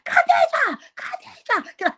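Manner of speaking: high cry of a name, then breathy; desperate mother calling. Retold or imitated.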